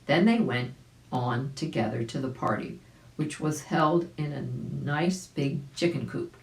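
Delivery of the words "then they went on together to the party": plain reading voice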